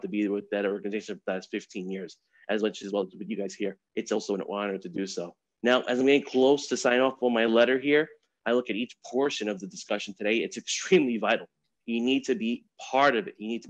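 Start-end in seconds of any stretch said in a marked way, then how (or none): none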